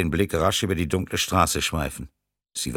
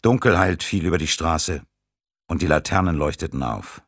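ordinary delivery, nothing marked

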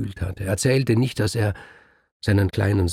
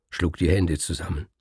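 ordinary delivery

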